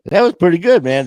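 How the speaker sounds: high and loud, pumped up; excited. Real, not acted. Host hyping himself after the speech, excited and loud. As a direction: cheer it like you just nailed it. Cheering his own rant.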